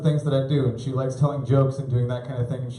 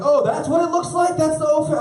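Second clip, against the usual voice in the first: much higher, louder shocked squeal; high shocked gasp at the O face; horrified realisation; 14 semitones higher, 4 dB louder than usual. Retold or imitated. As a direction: squeal as it dawns on you. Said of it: "I'm like".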